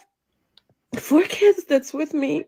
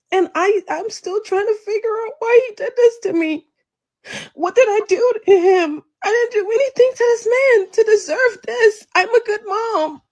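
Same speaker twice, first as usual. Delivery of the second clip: real tearful, pitch up; tearful, breaking with worn-out hurt; crying, worn out; 5 semitones higher, 3 dB louder than usual. Real, not acted. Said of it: "I'm so tired of crying".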